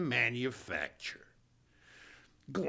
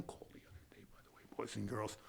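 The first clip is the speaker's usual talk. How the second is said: whisper; 6 semitones lower, 17 dB quieter, much faster than usual. Acted. Aside to audience, whispered.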